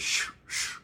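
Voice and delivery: unvoiced shh scraping noise; soft sharpening sound; imitated scraping. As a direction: hiss out the scrape of the blade.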